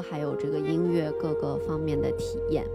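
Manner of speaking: usual speaking voice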